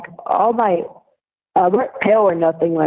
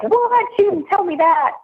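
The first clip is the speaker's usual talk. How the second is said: high-pitched mock disbelief; retold surprise; 13 semitones higher than usual. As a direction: act out your shocked reaction, pitched way up. Acting out own surprised reaction.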